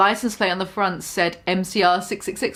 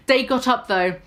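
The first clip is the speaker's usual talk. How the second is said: plain reading voice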